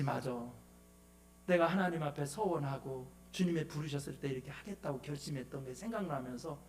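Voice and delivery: low, soft talking to oneself; retold quiet resolve. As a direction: recall a vow quietly, as if thinking aloud. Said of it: "하면서 영적으로 슬럼프를 다시 극복할 수가". (낮고 조용히 스스로 다짐하듯)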